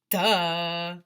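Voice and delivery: loud mocking duh; sarcastic duh. Narrator kid mocking the obvious, loud and sarcastic. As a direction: roll it like an eye-roll.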